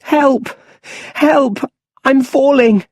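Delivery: put-on high, loud, terrified child's cry; little Bruce falling into the well, high and loud in panic; panicked shout. A narrator's reading. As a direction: scream for help as he slips. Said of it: "He's shouting".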